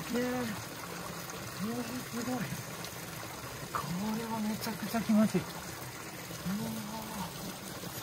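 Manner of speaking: high, soft, melting into the hot water; blissful sigh. Real, not acted. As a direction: breathe out pure relief. Sinks into hot spring. (気持ちよさそうな吐息)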